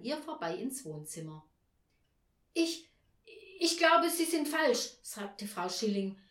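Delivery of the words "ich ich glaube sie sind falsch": high, loud, startled protest; halting and taken aback, pitched up high. A narrator's reading. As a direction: get over a fright as you speak, voice raised. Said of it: "von ihrem ersten Schreck erholt hatte".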